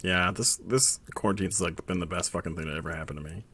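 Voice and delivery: ordinary talk, nothing marked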